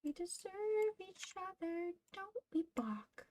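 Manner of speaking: high, soft, slow coo to a pet; cooing to the cat. Real, not acted. Cooing to her cat Bach.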